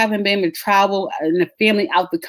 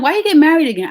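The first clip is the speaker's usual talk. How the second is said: put-on kids asking; 9 semitones higher, 5 dB louder, faster than usual. Kids asking, high and loud.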